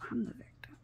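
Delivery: whispered, imitating self-pity; mock victim murmur. Imitating stepson playing the victim, hushed. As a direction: drop to a hushed mock lament.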